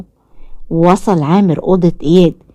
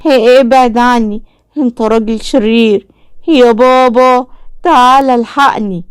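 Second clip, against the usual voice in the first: frightened yell; 6 semitones higher than usual. A narrator's reading. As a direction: scream for your father in terror. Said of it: "إياد بخوف وصراخ".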